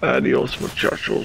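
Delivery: breathy whispered goodbye; playful hushed send-off; whispered farewell. Pretend. Echoes friend's farewell.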